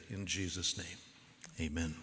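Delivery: ordinary talk, nothing marked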